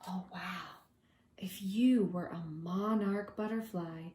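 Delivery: usual speaking voice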